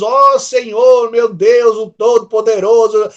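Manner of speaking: slow, loud, exalted prayer voice; grand formal prayer. Retold or imitated. Preacher showing how a distant believer addresses God. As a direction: proclaim it with pompous reverence. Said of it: "olha a distância que você tá tendo".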